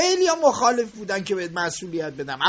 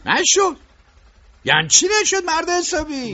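higher, louder, outraged echo of his excuse; indignant disbelief; 5 semitones higher, 5 dB louder than usual. Acted. Boss scolding his worker for losing the boy, indignant. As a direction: throw his words back at him in anger.

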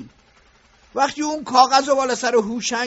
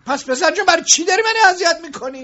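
higher, louder, pleading anger; exasperated reproach; 5 semitones higher, 6 dB louder than usual. Acted. Boss confronting the young foreman, exasperated. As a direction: finally confront him.

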